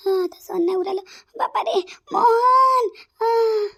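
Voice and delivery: hammed-up crying and groaning from a stomach ache; wailing in pain.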